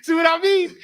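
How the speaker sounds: high, loud told-you-so; gleeful vindicated cry; triumphant. Retold or imitated. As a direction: friend gloating that he was right. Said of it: "Cap Kazan goes".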